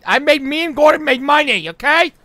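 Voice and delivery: very loud, insistent rant; heated boasting. Acted. Insisting me and Gordon get shit done, heated shouting. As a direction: shout down the doubter, hammering each claim.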